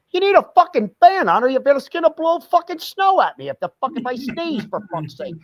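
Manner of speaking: hammed-up wife yelling for a fan; mock wife demanding. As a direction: squeal it like a sweaty angry wife.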